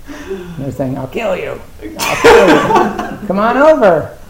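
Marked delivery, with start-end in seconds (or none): 2.0-3.3 much higher, much louder, slow squeal of "I'll kill you" breaking into a laugh. Pretend. Teacher repeating the bird's threat, laughing high squeal. 3.3-4.2 much higher, much louder strutting show-off; boastful bird. Pretend. Teacher voicing a bird courting a mate, loud boastful squawk.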